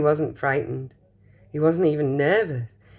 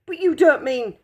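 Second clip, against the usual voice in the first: higher, louder protest; alarmed shout; 9 semitones higher, 4 dB louder than usual. Acted. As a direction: shout in disbelief. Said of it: "shouted Grandpa Joe".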